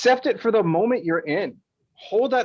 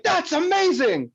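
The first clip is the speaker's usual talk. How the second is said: high, delighted exclamation; mock enthusiasm; 8 semitones higher than usual. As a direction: gush as if thrilled by the exercise. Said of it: "and be like".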